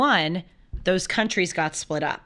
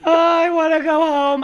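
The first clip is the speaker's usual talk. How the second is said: high, louder mock whine; mock whimper; 8 semitones higher, 6 dB louder than usual. Retelling the back-seat fear, high mock whine. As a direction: whine like a scared passenger. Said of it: "And I'm in the back seat like".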